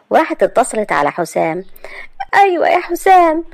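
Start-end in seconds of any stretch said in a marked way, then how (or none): 2.3-3.4 put-on high, tearful young woman's voice; a girl on the phone, close to tears; choked-up hello to her sweetheart. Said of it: "أنت بتعيطي؟".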